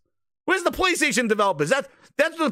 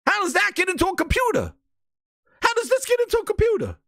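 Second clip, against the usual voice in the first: mock bafflement; 6 semitones higher, faster than usual. Baffled at paintings in game studio.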